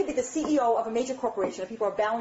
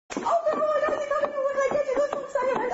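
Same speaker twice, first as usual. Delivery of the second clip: shrieking high, over-the-top delight; hammed-up squealing excitement; gushing. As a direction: fan greets a celebrity at the door. Gushing to Oprah at door.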